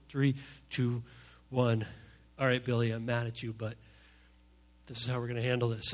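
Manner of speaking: low, restrained, cooled-off voice; calmed-down adult. Acted. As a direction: wind down, then address the child with restraint. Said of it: "so he handles it like".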